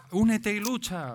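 slow and ominous, high pitched; slow dramatic. Acted. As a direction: intone it like a slogan. Quoting a mysterious slogan.

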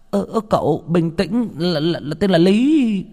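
high-pitched flustered servant; anxious placating of an angry master; nervous stammering. Acted. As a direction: stutter it out, dragging the name. Frightened servant calms angry master.

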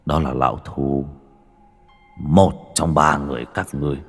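measured, grim villain's indictment; menacing accusation. A narrator's reading. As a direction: accuse them in weighted, separate phrases. Avenger accuses the old men.

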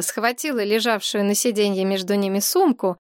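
plain reading voice

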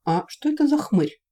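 high, wary question; alert, suspicious query. A narrator's reading. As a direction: ask it suspiciously, pitch lifted. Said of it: "насторожился Василиск".

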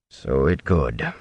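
deep, measured concession; unruffled companion. A narrator's reading. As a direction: answer the protest slowly, pitched well down. Companion repeats his reply while leaving.

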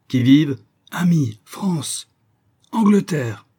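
high, loud sentry challenge and replies; calling out. A narrator's reading. Sentry and visitors exchange passwords at the rock, calling out. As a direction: call it out slowly across a distance.

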